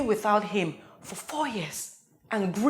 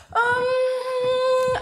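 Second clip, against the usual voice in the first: high, sustained sung "um"; drawn-out um; 13 semitones higher than usual. Acted. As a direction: hold one high stalling note. Stalling, drawn-out sung hum.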